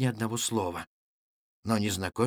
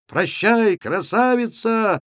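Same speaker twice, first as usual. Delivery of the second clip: high, slow, stretched holler; cried-out farewell; 9 semitones higher, 5 dB louder, slower than usual. A narrator's reading. Second lad to the supposed beauty, crying out. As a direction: sing out the goodbye loudly. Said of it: "вскричал другой".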